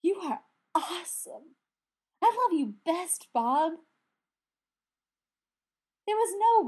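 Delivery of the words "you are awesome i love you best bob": high, loud delighted gushing. A narrator's reading. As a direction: rave about Bob with delight. Brendan gushing over Bob.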